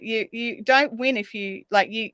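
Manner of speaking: usual speaking voice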